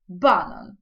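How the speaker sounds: "банан" stressed on the first syllable, BA; Polish stress. Shown as a demonstration. Teacher showing Polish stress on a familiar word, first syllable stressed. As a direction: hit BA in "банан". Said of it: "Точно так само зі словом".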